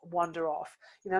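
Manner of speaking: ordinary delivery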